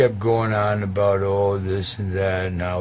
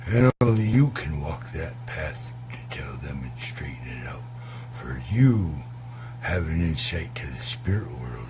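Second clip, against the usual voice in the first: hushed mystical whisper from the hawk; 5 semitones lower than usual. Retold or imitated. Hawk whispering the sacred task to Two Feathers. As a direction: breathe the message like a secret spirit call.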